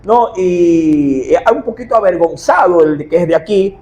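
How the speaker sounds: ordinary delivery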